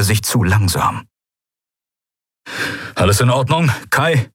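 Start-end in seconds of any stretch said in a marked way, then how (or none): 2.9-4.3 concerned question. Acted. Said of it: "fragte der Admiral".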